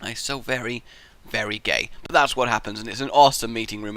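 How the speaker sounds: ordinary delivery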